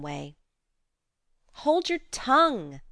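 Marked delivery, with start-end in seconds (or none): 1.6-2.8 higher, louder cry; cried out. A narrator's reading. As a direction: shut him up, covering ears. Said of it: "cried Joe, covering her ears".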